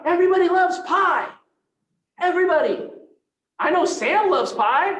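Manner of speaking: real delight about handing out pies; excited shout. Real, not acted. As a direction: sell it like pie is the answer to everything. Enthusing about pie at the audience.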